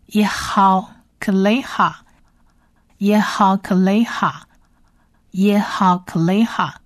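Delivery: "יהלכך" whispered slowly three times; whispered repeat. Shown as a demonstration. Teacher repeats "יהלכך" for learners in a slow whisper. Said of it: "再来第二列".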